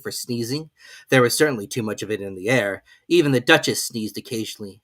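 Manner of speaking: ordinary delivery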